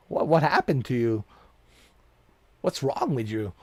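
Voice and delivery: normal talking voice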